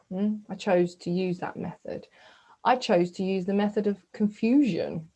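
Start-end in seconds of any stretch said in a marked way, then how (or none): none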